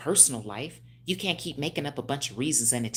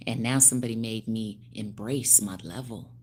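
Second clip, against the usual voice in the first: ordinary delivery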